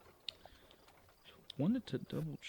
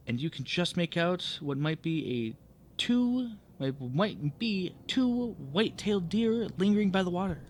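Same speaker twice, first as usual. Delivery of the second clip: louder, animated read-aloud narration; projected storytelling voice; story narration; 4 semitones higher, 8 dB louder than usual. Acted. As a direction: read it out like a game master setting the scene. Reads the adventure text aloud.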